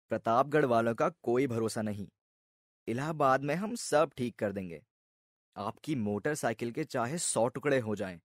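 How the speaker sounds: hushed, earnest salesman's assurances; reassuring insurance officer. Acted. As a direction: soothe the customer like an insurance man giving a little lecture. Voices insurance officer's reassuring speech.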